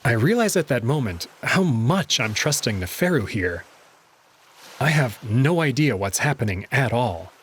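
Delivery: ordinary delivery, nothing marked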